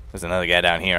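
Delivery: usual speaking voice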